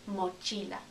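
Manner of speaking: normal talking voice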